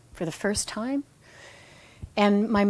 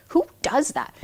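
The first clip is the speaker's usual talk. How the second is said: indignant disbelief; 8 semitones higher, 4 dB louder than usual. Retold or imitated. Judging thought again.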